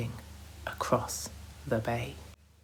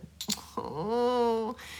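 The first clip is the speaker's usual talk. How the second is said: laughter; 9 semitones higher than usual. A narrator's reading. Boys laughing.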